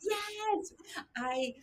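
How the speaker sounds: high, thrilled cheer; excited. Real, not acted. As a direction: cheer it out, delighted. Celebrating a perfect quiz score.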